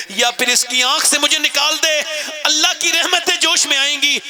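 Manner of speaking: high, loud fervent preaching; preaching climax. Real, not acted. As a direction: lift the crowd at the peak. (پرجوش بلند خطابت)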